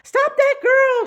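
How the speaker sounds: high, loud, slow witch shout. Acted. Checkout witch shouting after the girl, high shout. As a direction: yell after the fleeing girl. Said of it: "shouted the checkout witch".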